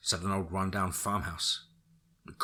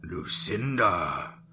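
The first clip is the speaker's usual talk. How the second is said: very slow, menacing stranger; ominous voice; much slower than usual. Acted. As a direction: draw it out, dark and threatening. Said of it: "an ominous voice said".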